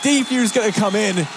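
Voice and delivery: hyped play-by-play, building to a shout; esports caster. Acted. Peak hype play-by-play.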